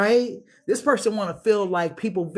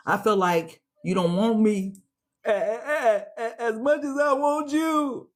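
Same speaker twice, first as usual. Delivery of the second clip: higher, halting "a-, a-", one half-sung "I"; vulnerable confession; 6 semitones higher than usual. Acted. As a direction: confess fear of rejection, trembling. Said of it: "This is how this person wants it to come through".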